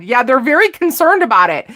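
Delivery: ordinary talk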